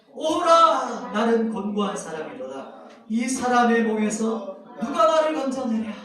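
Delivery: loud, drawn-out anguished cry of the verse; explosive outcry. Acted. Reading Paul's verse to the congregation as an explosive outcry. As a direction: burst out with the verse at full volume. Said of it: "그런 뉘앙스를 가지고".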